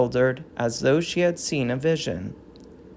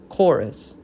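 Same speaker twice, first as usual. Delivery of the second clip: ordinary delivery, nothing marked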